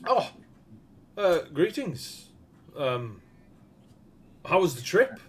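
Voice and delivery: lightly voiced smith, hesitant and surprised; smith greeting.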